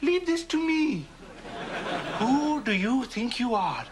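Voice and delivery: usual speaking voice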